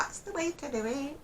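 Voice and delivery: high-pitched puppet catchphrase voice; Punch. Acted. Puppet show catchphrase to the viewers, high put-on voice. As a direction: squeak it like a seaside puppet. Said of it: "I sounded like Punch then, didn't I?".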